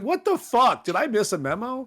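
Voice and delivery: high, incredulous what-the-hell; sudden confused disbelief; baffled outburst. Retold or imitated. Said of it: "I was like".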